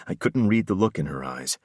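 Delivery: plain reading voice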